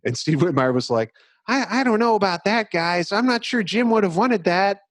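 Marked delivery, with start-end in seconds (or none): 1.5-4.8 high, hesitant mock Kermit voice; timid Kermit-like protest. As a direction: squeak it out like a nervous puppet. Mock Steve Whitmire protesting.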